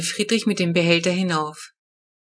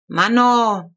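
put-on sulky toddler giving in to dad; sulky grumble; 3 semitones higher, 5 dB louder than usual. A narrator's reading. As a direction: grumble it like a pouting kid. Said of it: "maulte Flo".

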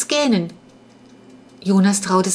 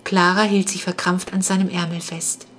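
ordinary delivery

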